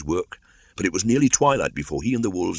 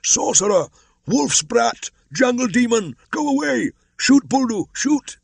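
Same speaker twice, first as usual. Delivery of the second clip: high, loud mob yells, each cry hurled; villagers shouting; 7 dB louder than usual. A narrator's reading. Villagers hurling abuse at the boy, shrill loud yelling. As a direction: scream abuse at him like a frightened mob. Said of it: "The villagers shouted".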